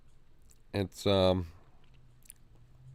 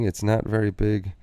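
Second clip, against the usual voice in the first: normal talking voice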